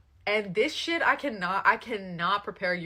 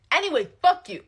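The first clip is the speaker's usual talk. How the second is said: mock yelled brush-off; 8 semitones higher, 11 dB louder than usual. Couple quarrel mid-flight, mock blowup.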